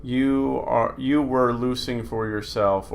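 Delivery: ordinary delivery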